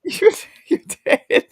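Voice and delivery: high and laughing through words. Real, not acted. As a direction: giggle it out. Laughing at frozen face.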